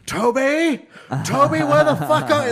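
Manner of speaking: high, loud furious yell; retold screaming. Host screaming down to the owner's son, furious yell. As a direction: bellow it like a furious boss. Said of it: "he's, like, screaming for this".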